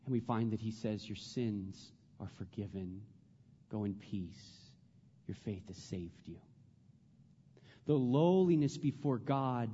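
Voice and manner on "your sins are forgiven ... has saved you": gentle forgiveness. Acted. Jesus speaking to the woman.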